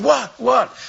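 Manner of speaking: high, loud alarmed "What?"; startled awake and confused; startled wife. Retold or imitated. Wife bounced out of bed, asking high and alarmed. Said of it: "she's going".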